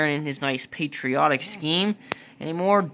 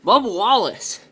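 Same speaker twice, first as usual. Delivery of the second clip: race caller; 4 semitones higher, 3 dB louder than usual. Acted. Live race play-by-play.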